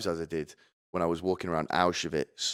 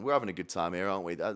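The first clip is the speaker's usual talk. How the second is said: usual speaking voice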